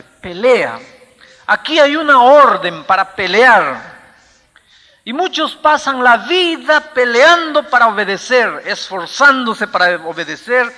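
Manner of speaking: loud, high preacher's exhortation; forceful preaching. Real, not acted. Preacher urging the congregation to fight the good fight, forceful preaching. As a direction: hammer the verse out, drawing out key words.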